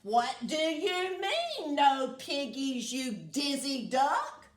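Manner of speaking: loud, high screeching witch. Acted. Witch screeching at the dizzy duck. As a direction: screech right into the duck's face. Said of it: "Gritch screeched into his bill".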